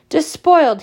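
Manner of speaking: high, loud outraged hiss. A narrator's reading. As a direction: spit the word out, scandalised. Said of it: "she hissed".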